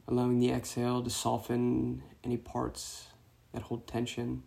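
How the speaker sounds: soft meditation voice with long pauses; guided meditation. Real, not acted. Meditation teacher guiding runners to release tension, soft guided-meditation voice. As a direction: guide them gently into the breath, hushed and unhurried.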